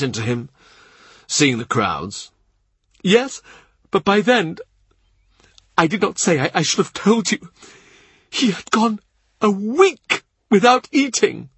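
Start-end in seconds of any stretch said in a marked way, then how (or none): 3.0-11.5 much higher grieving servant's voice; tearful Cavendish. A narrator's reading. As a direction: lift it high like a tearful old retainer. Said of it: "He cries as he talks".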